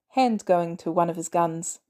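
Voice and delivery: ordinary delivery, nothing marked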